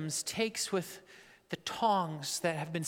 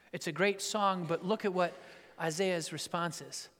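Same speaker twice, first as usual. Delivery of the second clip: usual speaking voice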